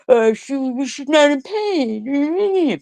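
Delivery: high, slow mock of a skeptic; mocking doubter. Mimicking haters who doubt her pain, high and slow. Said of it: "and you question yourself".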